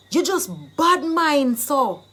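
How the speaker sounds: emphatic, indignant call-out; accusing, jealousy charge. Shown as a demonstration. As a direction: hit "bad mind" hard. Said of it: "You are just jealous of me".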